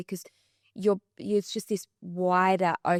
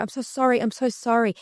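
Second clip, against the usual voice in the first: higher-pitched, gushing mock apology; over-apologetic; 4 semitones higher, 5 dB louder than usual. Woman imitating a stranger who bumped into her, over-apologetic. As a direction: act out a profuse apology. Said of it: "Everyone's so apologetic".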